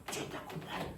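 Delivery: soft whisper to let it sink in; whispered assurance. Real, not acted. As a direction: whisper it as a quiet reminder. (chuchoté, pour rassurer)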